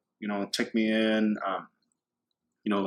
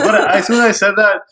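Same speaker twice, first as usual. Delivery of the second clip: laughing; 10 semitones higher, 10 dB louder than usual. Real, not acted. Laughing at own mistake.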